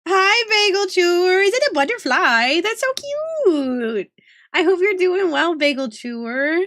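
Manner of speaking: high, drawn-out cutesy cooing; gushing cutesy greeting. Pretend. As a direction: coo it high, stretching the ends. Gushing greeting to a chat member.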